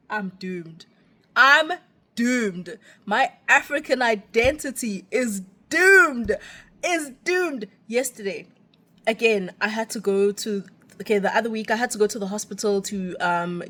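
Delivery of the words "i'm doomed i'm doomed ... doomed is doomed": drawn-out theatrical doom; mock despair. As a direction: wail it like a hammed-up tragedy. Mock despair over lost accent.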